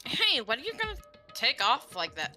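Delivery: ordinary delivery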